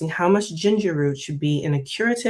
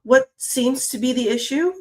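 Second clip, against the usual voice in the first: higher-pitched, nervous shop worker; 7 semitones higher than usual. Acted. Shop worker answering a displeased customer, nervous. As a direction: stammer the start, voice lifted with nerves. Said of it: "the shop worker asked nervously".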